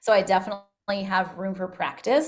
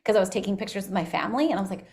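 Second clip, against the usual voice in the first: ordinary talk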